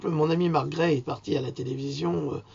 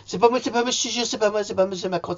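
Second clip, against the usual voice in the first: higher put-on whiny voice, lightly voiced; mock Macron denial; 5 semitones higher, faster than usual. Mocking Macron denying the scandal in a light whiny voice. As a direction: whine that it wasn't you. C'est pas monsieur Macron.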